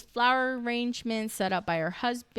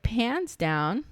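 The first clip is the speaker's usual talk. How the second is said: drawn out slowly; 2 semitones higher than usual. Acted. Repeats the camera pan slowly.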